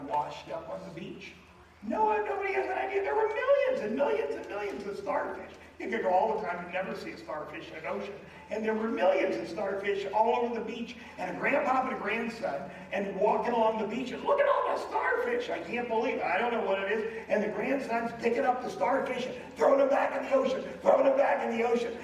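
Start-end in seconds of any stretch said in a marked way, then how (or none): 1.8-14.2 loud, high, driving sermon delivery; full-force preaching. Real, not acted. 14.2-15.5 high, loud amazed grandson; excited child. Retold or imitated. 15.5-22.0 loud, high, driving sermon delivery; full-force preaching. Real, not acted.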